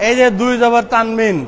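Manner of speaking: usual speaking voice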